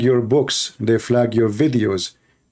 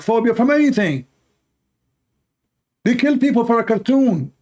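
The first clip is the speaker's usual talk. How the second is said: preaching at full force; 5 semitones higher, faster than usual. Acted. Preacher railing at his audience, rising fervour. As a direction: build the rant to a fever pitch. Rising tirade against Muslims.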